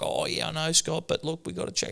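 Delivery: high, soft specialist imitation; mimicking specialist. Specialist soothing an angry Dad, in a high, hushed voice. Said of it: "He's like".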